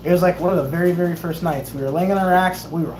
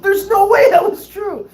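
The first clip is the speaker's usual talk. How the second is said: high, loud, drawn-out disbelief; hammed-up exasperation; incredulous protest; 13 semitones higher, 5 dB louder, slower than usual. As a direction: insist on it, slow and pitched way up. Acts out protesting innocence.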